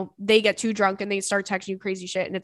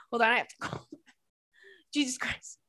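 laughing and coughing; 4 semitones higher, 6 dB quieter than usual. Real, not acted. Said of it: "I can't even laugh anymore without, without coughing".

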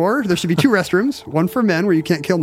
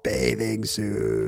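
zombie groan; 7 semitones lower, 7 dB quieter, much slower than usual. Pretend. Echoing zombie voice.

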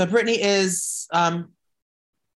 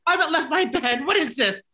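much higher, frantic mock-outraged voice; retelling the bank call, a high frantic protest; high shrill panic; 12 semitones higher than usual. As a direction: squeal it like a panicked diva. Retold panic on the phone.

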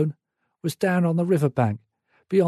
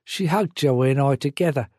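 plain reading voice

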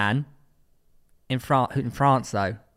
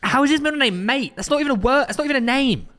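incredulous; 10 semitones higher than usual. Real, not acted. Incredulous at middle name.

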